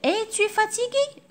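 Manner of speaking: high inverted question; Es-tu fatigué asked clearly as a question; model question. Shown as a demonstration. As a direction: ask it with a high lift. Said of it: "Sinon on dit".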